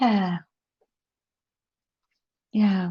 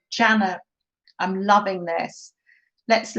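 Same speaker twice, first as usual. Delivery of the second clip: ordinary talk, nothing marked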